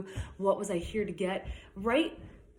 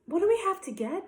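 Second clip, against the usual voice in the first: put-on higher, louder little girl's voice; child's question; 12 semitones higher, 4 dB louder, faster than usual. Becky asks Josh at the shopping cart, high and puzzled. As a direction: ask it like a puzzled little girl. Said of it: "asked Becky".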